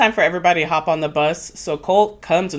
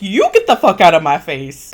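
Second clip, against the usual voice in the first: louder mock retort; snapping back; 6 dB louder than usual. She fires back at Tim, loud mock retort. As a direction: throw it straight back, hammed-up and forceful. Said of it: "And she's like".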